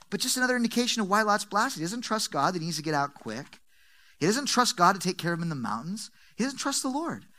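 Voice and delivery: ordinary talk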